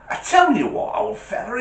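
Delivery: high, bright proposal; driver's offer. Retold or imitated. Driver offering the old man a tow, high and eager. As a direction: lean in with a bright idea. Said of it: "He goes".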